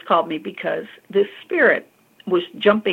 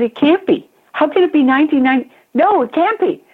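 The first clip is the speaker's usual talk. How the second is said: high, loud, rapid-fire protest; worked-up retold denial, pitch leaping up; upset disbelief; 8 semitones higher, 5 dB louder, faster than usual. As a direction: act out his agitated refusal to believe. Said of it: "he got all upset again".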